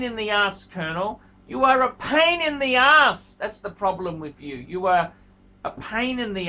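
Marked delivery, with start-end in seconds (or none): 1.5-3.4 exasperated scolding. Real, not acted. Scolding the Colonel.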